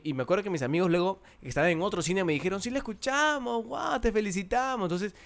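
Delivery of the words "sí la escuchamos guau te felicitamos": higher, softer retold cheer; mock-excited praise, pitched up; excited congratulating. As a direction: congratulate like impressed friends. Friends' congratulations acted out.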